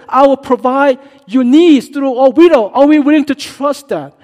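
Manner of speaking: high, loud fiery preaching; preaching at full force. Real, not acted. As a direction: drive the challenge home to the congregation.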